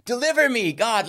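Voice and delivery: high mock plea for rescue; retold desperate prayer. Student praying for himself, high retold pleading. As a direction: cry out to God, reenacted. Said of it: "praying for myself".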